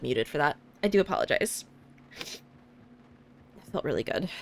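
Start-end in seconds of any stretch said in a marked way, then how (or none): 2.2-4.3 soft unvoiced slow sigh of relief; breathy relief. Real, not acted. Relief after sneeze.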